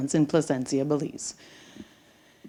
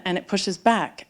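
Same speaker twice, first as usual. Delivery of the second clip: ordinary talk, nothing marked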